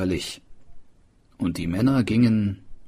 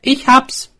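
high, loud eureka cry; excited discovery; 9 dB louder than usual. A narrator's reading. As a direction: burst out with the idea. Swineherd's sudden idea.

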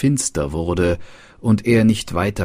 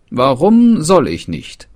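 higher and louder, a prince demanding why; indignant question; 8 semitones higher, 8 dB louder than usual. Acted. Prince demands to know why he must not enter, raised and higher. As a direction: push back hard on the warning.

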